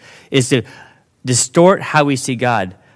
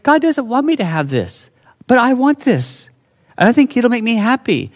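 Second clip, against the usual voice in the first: whiny self; 9 semitones higher than usual. Retold or imitated. Voicing his own temptation.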